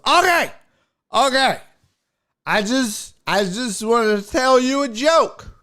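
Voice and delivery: put-on high, slurred drunken pleading; mumbling drunk heckler. Drunk heckler wants to tell a joke, slurred insisting. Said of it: "hear you mumble rap your way".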